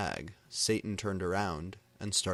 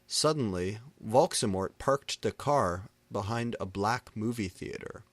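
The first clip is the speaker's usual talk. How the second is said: plain reading voice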